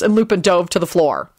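usual speaking voice

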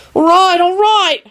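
high, drawn-out exasperated call; long high-pitched holler; shouting. A narrator's reading. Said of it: "Harry shouted".

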